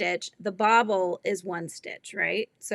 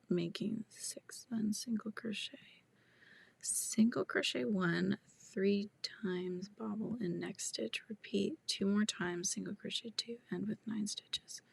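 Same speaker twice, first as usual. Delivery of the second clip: reading to herself; 13 dB quieter than usual. Real, not acted. Crocheter puzzling over a viewer's pattern, quietly reading to herself. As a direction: read it under your breath, working it out.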